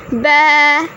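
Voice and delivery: bleating like a sheep; playful sheep noise. Acted. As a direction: baa it out like a sheep. Sheep voice in story.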